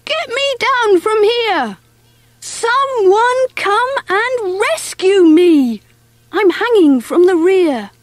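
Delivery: high, desperate storybook shout; shouting for rescue. A narrator's reading. Mouse hanging from the boat's rear, shouting. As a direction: cry out high to anyone in earshot. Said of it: "Mervyn shouted".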